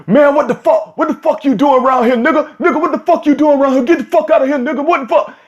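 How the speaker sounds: high-pitched furious yelling, mimicking the guy; angry guy retold. Caught man yelling at passing jogger, imitated high and furious.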